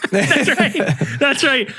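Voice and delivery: high-pitched, laughing through the words; laughing agreement. Real, not acted. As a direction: agree while cracking up. Laughing at remembered meter.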